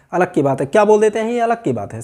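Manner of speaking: normal talking voice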